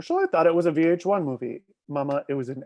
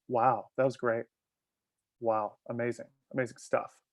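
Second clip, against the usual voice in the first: mock praise; 4 semitones lower, 6 dB quieter than usual. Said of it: "in a joke, he was, like, joking".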